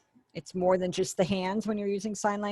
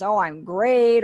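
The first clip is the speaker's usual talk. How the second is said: louder, slow and beaming; enthusiastic great; 3 semitones higher, 8 dB louder, slower than usual. Shown as a demonstration. Teacher showing a student a thrilled reply. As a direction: sell how great you feel.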